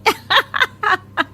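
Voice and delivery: high mocking laugh. Bride mocking mother-in-law over the beggar's sari. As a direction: laugh at her own jibe.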